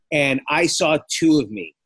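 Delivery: normal talking voice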